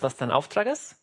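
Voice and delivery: usual speaking voice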